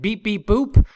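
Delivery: loud playful beep beep boop; keypad beeps. Pretend. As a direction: mimic the keypad tones. Imitating gate keypad.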